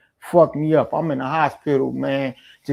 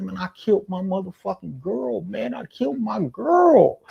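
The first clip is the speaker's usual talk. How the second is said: high, broken mock sob, softer; imitating crying man; 5 semitones higher, 3 dB quieter than usual. Neighbor confessing he killed his girl, mock sobbing high. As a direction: voice the grieving man's choked confession. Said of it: "he crying like a motherfucker".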